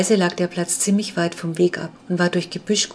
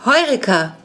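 a mild shout of discovery, higher; excited cry; 4 semitones higher, 4 dB louder than usual. A narrator's reading. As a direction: call out the find, thrilled. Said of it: "schrie Ferdinand auf".

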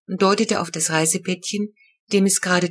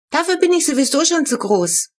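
put-on much higher, trumpeting little-boy voice; patronizing announcement; 9 semitones higher, 3 dB louder than usual. A narrator's reading. Little Flo offering his pool to the raccoons, grandly. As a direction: announce it grandly, a child being generous. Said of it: "posaunte Flo gönnerhaft".

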